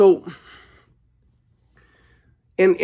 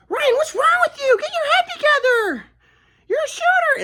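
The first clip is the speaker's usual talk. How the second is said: much higher, mocking falsetto mom voice; mock her as a high-pitched scolding mom; 3 dB louder than usual. Mom scolding son after his game, mocking falsetto. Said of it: "She's like".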